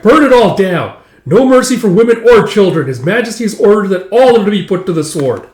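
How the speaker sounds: loud, high-pitched battle orders; shouted command. Retold or imitated. Commander ordering soldiers to burn everything, shouted command. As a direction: bellow merciless orders over the raid.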